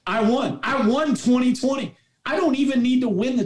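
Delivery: high-pitched, exultant recounting; retold elation. Coach retelling his joy for a player, elated. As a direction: relive the triumph, pitch lifted high.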